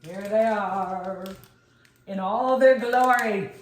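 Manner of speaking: slow, loud showman reveal; grand reveal. Acted. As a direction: unveil them like a prize. Presenting the finished kale chips.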